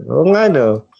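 ordinary talk

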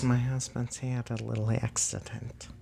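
very soft, hushed wife voice; imitated wife. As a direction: mimic her breaking awkward news under her breath. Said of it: "she says".

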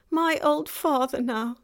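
high, loud, wailing self-reproach; distraught faun. A narrator's reading. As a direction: lament loudly as a guilt-stricken faun. Faun laments about his father.

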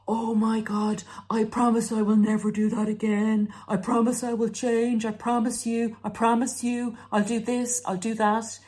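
pleading promises. Retold or imitated. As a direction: beg and promise over and over. Narcissist pleading to come back.

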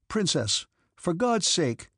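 urgent exclamation. A narrator's reading. Count trying to stop the fleeing princess, urgently exclaiming. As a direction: call after them to stop, imploring. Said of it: "he exclaimed, trying to stop her".

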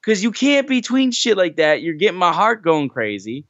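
very high, squealing fan scold; hammed-up squeal of fan frustration; mock exasperated scolding. Fan tells Dax to stop teasing matches, squealing high. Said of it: "I was like".

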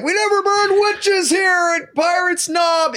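shouting town official. Pretend. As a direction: shout it like a defensive town mayor.

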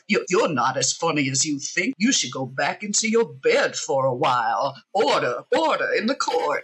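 lightly voiced pompous Southern judge; Foghorn Leghorn voice. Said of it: "talks like Foghorn Leghorn".

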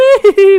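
a sudden, very loud and high burst of laughter; shrieking laugh. Real, not acted. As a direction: burst out cackling. Laughs at the camisole joke. (éclat de rire très fort et aigu)

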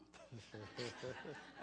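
real laugh. Real, not acted. Wife laughing at her own joke.

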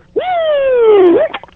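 high sustained siren wail; piercing, held siren imitation; imitated siren. Shown as a demonstration. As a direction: do your siren noise with your voice. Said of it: "do your siren at the".

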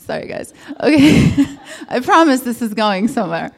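flustered, laughing it off. Real, not acted. Laughing embarrassed apology.

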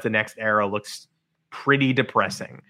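normal talking voice

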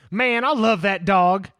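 loud, slow imitation of a big lineman; Billy Bob. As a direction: draw it out loudly, imitating his fond boast. Quoting drawling film character Billy Bob.

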